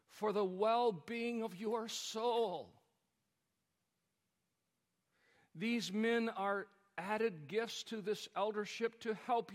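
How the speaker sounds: loud, slow, ringing pulpit climax; preaching at full force. Acted. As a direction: drive it home to the congregation, drawn out.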